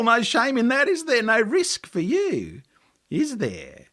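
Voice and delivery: high, mocking tempter voice; taunting devil. A narrator's reading. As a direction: sneer and goad him from above.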